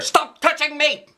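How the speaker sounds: high, loud angry holler. Acted. Lucille fed up with Junie, high loud holler. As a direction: yell it right in her face. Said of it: "she hollered".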